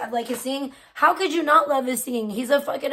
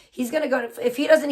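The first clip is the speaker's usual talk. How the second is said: ordinary delivery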